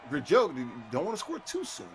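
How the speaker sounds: usual speaking voice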